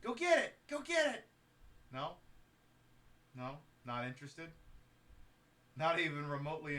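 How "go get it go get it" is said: excited high call. Real, not acted. Urging the pet.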